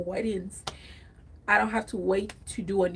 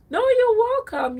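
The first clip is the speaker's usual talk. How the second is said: excited thanks; 13 semitones higher, 9 dB louder than usual. Real, not acted. Thanking a viewer warmly.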